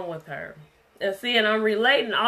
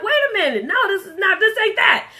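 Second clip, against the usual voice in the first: loud, higher, laughing mock outburst; getting loud; 10 semitones higher, 8 dB louder, faster than usual. Preacher mimicking an argument getting heated, mock loud outburst. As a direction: blow up half-jokingly in a loud raised voice. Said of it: "then we'll get loud".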